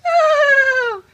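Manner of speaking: high squealing from the cold; in ice cold water. Acted. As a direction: shriek through the chill. Still under ice water.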